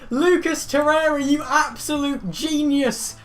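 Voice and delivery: very high, very loud goal celebration; ecstatic screaming. Real, not acted. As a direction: scream it like the winner just went in. Fan celebrating a bicycle-kick goal.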